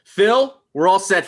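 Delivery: called up high and loud to the booth; calling out. Acted. John calls up to the lighting booth, loud and high. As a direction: raise the voice to reach the booth.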